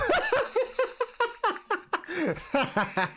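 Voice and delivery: high, rattling laughing fit; uncontrolled laughter. Real, not acted. Streamer cracks up as the match restarts, uncontrolled laughter. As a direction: burst out laughing mid-word.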